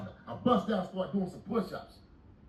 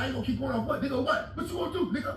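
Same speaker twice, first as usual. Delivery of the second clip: higher, heated mock challenge; challenging the cellmate, pitched up; retold challenge; 8 semitones higher, faster than usual. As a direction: dare him to do something.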